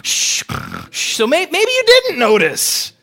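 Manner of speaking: high, loud mock-exasperated Peter; snarky Simon Peter. Peter shouting back at Jesus about the storm, high and exasperated. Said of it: "I just picture somebody like Simon Peter going".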